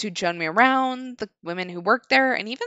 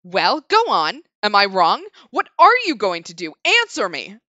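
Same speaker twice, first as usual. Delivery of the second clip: demanding scold; 8 dB louder than usual. Acted. Woman demands answer.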